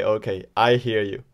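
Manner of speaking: usual speaking voice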